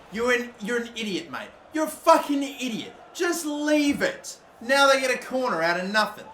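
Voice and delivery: real frustration yelled at the screen; real angry outburst. Real, not acted. Gamer yelling at his useless defender, angry. Angry at own player's mistake.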